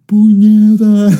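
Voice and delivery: long, high, loud drawn-out yell. Retold or imitated. Imitating friend yelling on the ride, full-out holler. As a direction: holler it out and stretch the vowels. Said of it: "He was yelling".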